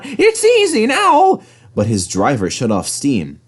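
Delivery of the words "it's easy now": high, slow and pleased; a smug little train, much higher and slow; high engine voice. A narrator's reading. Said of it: "he thought".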